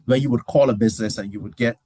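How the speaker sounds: normal talking voice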